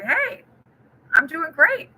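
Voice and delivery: the drive-through guy caught off guard but delighted; startled worker, pitched high and happy; surprised cheerful reply. Retold or imitated. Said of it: "He's like".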